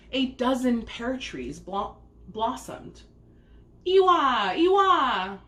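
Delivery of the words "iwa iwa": much higher, loud bird chirp; chirping sparrows. A narrator's reading. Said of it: "chirped the sparrows".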